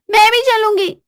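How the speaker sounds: high, pushy insistence; insistent wife. Acted. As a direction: call after him, demanding to come. Wife follows, repeats demand.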